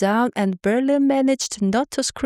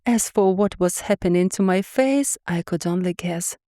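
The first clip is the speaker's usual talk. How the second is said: ordinary delivery, nothing marked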